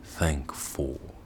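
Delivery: thankful soft and low, ending inside; inward thankful. Shown as a demonstration. Teacher demonstrating where energy ends, soft. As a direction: let thankful end within you. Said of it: "Notice where the energy ends".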